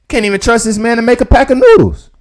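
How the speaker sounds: exasperated laughing-at-him rant; incredulous rant. Real, not acted. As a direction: vent the disbelief loudly. Ranting at the clip.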